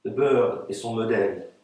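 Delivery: measured, articulated recitation of a poem; poetry reading. Acted. Poet reading her text to an audience, measured recitation. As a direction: recite it line by line, with pauses. Poets read their texts aloud.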